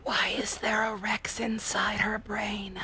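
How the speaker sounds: hushed, slow whisper. Pretend. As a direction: whisper it as if it might hear. Joking whisper about game character.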